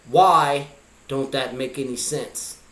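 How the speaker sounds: ordinary delivery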